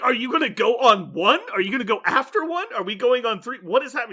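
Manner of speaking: high, loud flustered questions; hammed-up confusion; bewildered Tom Cruise. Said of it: "He's like".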